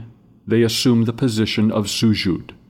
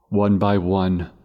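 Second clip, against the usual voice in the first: usual speaking voice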